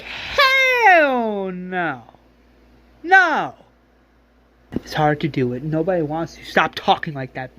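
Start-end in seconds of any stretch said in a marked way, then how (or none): 0.0-3.5 sharp rising no, much higher and louder; loud refusal. Retold or imitated. Billy refusing Beth's suicide pact, loud high outburst. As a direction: throw out the no as a shocked outburst. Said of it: "Billy's like".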